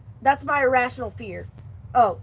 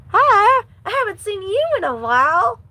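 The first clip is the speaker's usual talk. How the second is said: country twang; 9 semitones higher, 8 dB louder than usual. Real, not acted. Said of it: "Let me fix my voice".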